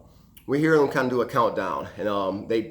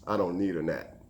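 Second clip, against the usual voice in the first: lower, softer unbothered reply; cool; 5 semitones lower, 6 dB quieter than usual. Retold or imitated. Dog warden brushing off the safety net question, cool. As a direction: play it cool like a seasoned old hand. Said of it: "just as cool as he could be".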